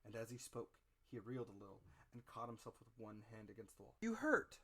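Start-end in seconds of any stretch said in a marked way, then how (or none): 4.0-4.6 high alarmed shout; crying out. A narrator's reading. Boy crying out to the reeling captain, alarmed shout. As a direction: call out in fright to the captain. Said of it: "cried I".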